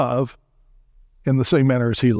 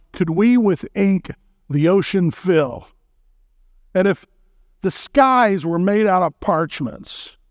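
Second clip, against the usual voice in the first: slow, measured reading of a hymn verse; hymn recitation; 4 semitones higher, slower than usual. Acted. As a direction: recite it like a poem, unhurried.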